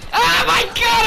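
high, loud cry of alarm; panicked shout. Real, not acted. Gamer running for their life, panicked shout. Fleeing enemies in game.

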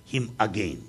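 ordinary talk, nothing marked

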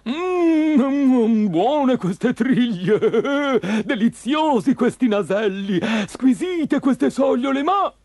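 high, loud, slow savouring voice; gloating fisherman. Acted. Green fisherman admiring his catch, greedy and relishing. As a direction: drool over each fish, drawing the words out.

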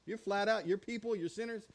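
ordinary talk

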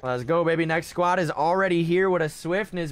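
usual speaking voice

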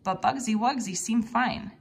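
ordinary delivery, nothing marked

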